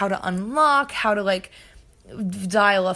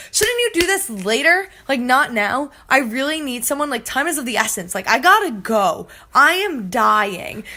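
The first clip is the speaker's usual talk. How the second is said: acted urgent protest; 3 semitones higher, 5 dB louder than usual. Acts out urgent protest to operator.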